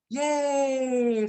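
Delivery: high, drawn-out happy yay; child's cheer. Retold or imitated. Child among scared adults, cheering. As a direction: imitate a delighted little kid. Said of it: "Kok yang ini".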